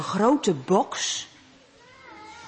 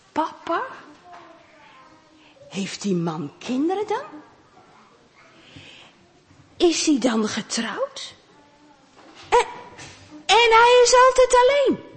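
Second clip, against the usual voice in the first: put-on puzzled child; 7 semitones higher than usual. Timon puzzling aloud.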